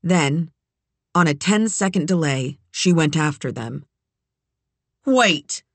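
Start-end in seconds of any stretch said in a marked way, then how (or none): 5.1-5.6 high shout, calling out; yelling. A narrator's reading. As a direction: shout after them to stop. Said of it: "she yelled".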